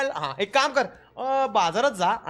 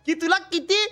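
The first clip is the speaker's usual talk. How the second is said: delighted praise; 9 semitones higher than usual. Real, not acted. Delighted praise of AI's answer.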